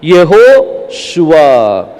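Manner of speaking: यहोशुआ spelt out very slowly, syllable by syllable, falling at the end; teacher modelling the name slowly; drawn-out name. Shown as a demonstration.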